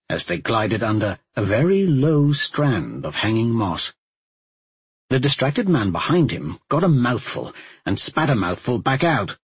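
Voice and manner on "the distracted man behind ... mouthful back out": plain reading voice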